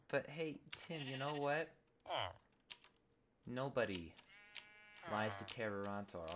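normal talking voice

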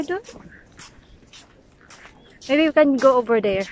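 ordinary talk, nothing marked

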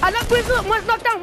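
high, loud hurried callout; excited callout. Real, not acted. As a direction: blurt it out in the heat of the fight. Reporting a knock mid-fight.

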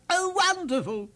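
high, loud delighted king; delighted king cry. A narrator's reading. King cries out at the juggling lion, delighted. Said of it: "he cried".

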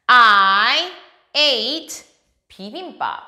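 normal talking voice

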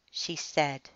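ordinary delivery, nothing marked

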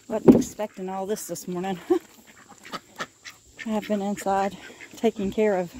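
ordinary talk, nothing marked

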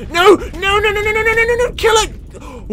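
high panicked no, no, no. Real, not acted. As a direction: squeal it out in a panic. Big fish attacking him.

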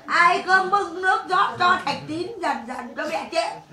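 slow, high mock wail breaking into laughter; mocking fake lament. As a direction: sneer at her false grief in a sing-song wail. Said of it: "Mẹ bố nó điêu quá cơ". (giả giọng khóc than mỉa mai, bật cười)

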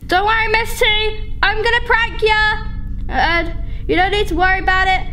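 high, sing-out mock reassurance; playful taunt. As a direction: tease the one you're about to prank. Mock-reassures game opponent before prank.

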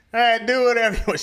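high, loud, quick brush-off; dismissive. Retold or imitated. Brushing off ex-girlfriend, high and dismissive. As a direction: wave her off fast.

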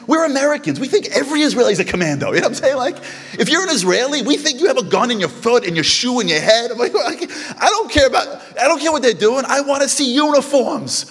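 high, loud comic complaint; hammed-up demand for uniforms and guns; mock indignant rant. Joking to the crowd about American expectations, high mock rant.

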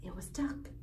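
plain reading voice

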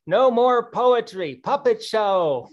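loud, slow, fed-up cry; mock exasperated shout. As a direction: bellow it like a fed-up kid. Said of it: "he would say".

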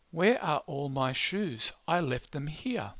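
slow and loud, each word spelled out for the app; careful reading to a listening program; slow, clear read-aloud. Shown as a demonstration. Rereading the story line for app.

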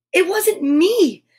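high, drawn-out protest; crying out. A narrator's reading. Noddy denying he took the biscuits, crying out. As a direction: stretch out an indignant denial, pitched high. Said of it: "cried Noddy".

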